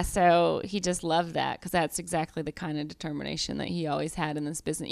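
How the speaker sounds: ordinary talk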